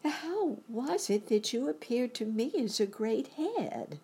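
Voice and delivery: high-pitched, puzzled Dorothy; young girl's voice. A narrator's reading.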